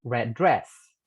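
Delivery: "red dress" joined into one word, the d sounds merged; linked consonants. Shown as a demonstration. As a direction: run "red dress" together with no break. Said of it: "Y vamos a decir". (unir "red dress" como una sola palabra)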